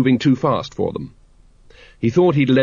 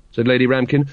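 usual speaking voice

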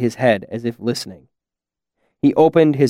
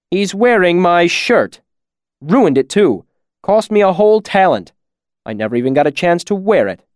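raised, aggrieved voice; heated, higher-pitched grievance; indignant complaint; 6 semitones higher, 4 dB louder than usual. A narrator's reading. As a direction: complain bitterly about what the thief took. Robbed man complains, after humorless laugh.

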